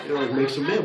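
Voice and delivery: normal talking voice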